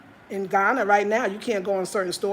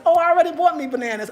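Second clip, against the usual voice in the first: fast, louder, playfully dismissive; breezy brush-off; 5 semitones higher, 6 dB louder, faster than usual. Retold or imitated. Telling the auntie she already bought bananas, quick and breezy. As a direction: brush her off quickly and lightly.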